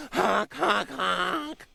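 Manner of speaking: honking like a goose; goose honks. Retold or imitated. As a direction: honk like a goose.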